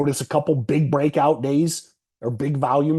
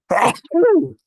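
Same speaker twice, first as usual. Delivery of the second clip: loud sudden sneeze; one sharp real sneeze; sneezing; 7 dB louder than usual. Real, not acted. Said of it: "Sorry about the sneeze".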